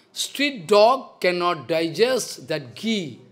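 slow, weighty quoting of his guru; slow quoted saying. Retold or imitated. Guru quoting his own teacher to listeners, slow and weighty. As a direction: spell the old saying out word by word. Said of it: "my Guru Padma used to say".

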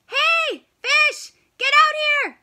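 high, loud yell; calling out. Acted. Hungry penguin shouting into the sea, high and loud. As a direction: shout for the fish to come out.

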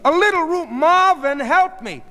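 high, loud call for help; exasperated command. A narrator's reading. Sheila to Marvin in the crowd, shouted exasperated command.

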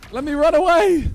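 panicking gamer. Real, not acted. Fleeing an enemy.

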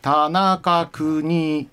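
田中邦衛 drawn out very slowly on a level accent; 平板アクセント. Shown as a demonstration. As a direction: say 田中邦衛 slowly, without pitch drops. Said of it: "平板ですね". (「田中邦衛」をゆっくり平板に)

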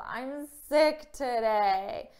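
ordinary talk, nothing marked

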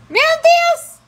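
high, loud surprised exclaim; high playful shock; surprised cry. Real, not acted. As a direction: gasp in mock shock. Surprise at the baby.

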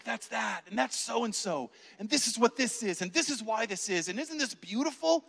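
high, light enthusiastic chatter; imitating an excited nun showing visitors around; eager tour guide. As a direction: point out one wonder after another. Nun eagerly pointing out all the saints.

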